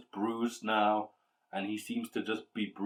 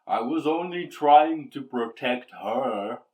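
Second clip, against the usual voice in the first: louder, raised demon plea; 4 semitones higher, 8 dB louder than usual. Acted. Bound demon explains itself to the Twilight King, raised demon voice. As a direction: insist like a cornered beast.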